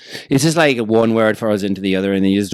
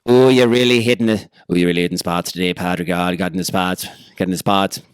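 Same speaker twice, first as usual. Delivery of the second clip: mock Irish dirty talk; 4 semitones lower than usual. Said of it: "how Irish people dirty talk".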